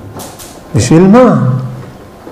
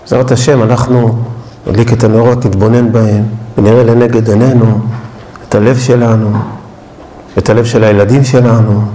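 prayerful guided reflection; 9 semitones lower than usual. Acted. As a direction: lead them gently toward the candles. Leads a candle-lighting reflection.

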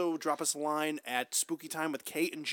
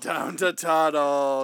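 savored slogan; 5 dB louder, slower than usual. Real, not acted. Savoring the proposed shirt slogan.

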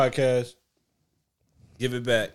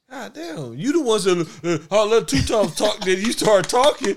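exasperated; 7 semitones higher, 4 dB louder than usual. Real, not acted. Heated mock complaint.

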